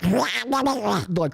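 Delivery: high, garbled angry quacking noise; Donald Duck sputter. Retold or imitated. Adult fuming through the situation, Donald Duck sputter. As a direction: sputter wordlessly like a ranting cartoon duck. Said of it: "basically Donald Ducking my way through".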